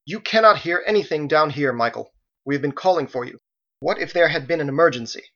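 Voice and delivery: indignant, forceful telling-off; haranguing. A narrator's reading. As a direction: harangue him for his carelessness. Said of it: "She harangued Michael as she went".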